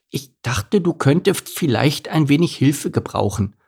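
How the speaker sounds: high, modest little donkey voice; Elimar, lisping. A narrator's reading. Donkey offers the fallen camel help, high gentle lisp. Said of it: "durch das Lispeln".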